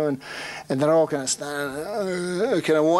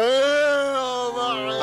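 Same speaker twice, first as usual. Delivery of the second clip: crowd cheering; 11 semitones higher, much slower than usual. Retold or imitated. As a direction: roar it like a rowdy footy crowd. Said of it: "all these guys go".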